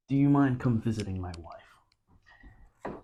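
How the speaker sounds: slow, unvoiced whisper as [name]. [name] asks Robin Williams to visit his wife, whispered. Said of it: "and he's like".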